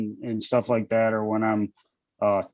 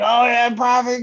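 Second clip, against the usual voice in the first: high-pitched mock excitement; high, eager quoted reply; excited imitation; 11 semitones higher, 4 dB louder than usual. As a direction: imitate a stoked buddy, pitched way up. Acting out friend's eager reply.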